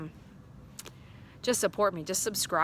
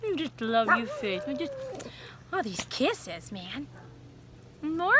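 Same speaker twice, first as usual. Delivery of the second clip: playful pet talk; 8 semitones higher than usual. Real, not acted. Owner playing with her dog Faith, playful baby talk. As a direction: play lovingly with a kissy dog. Playing kissing games with dogs.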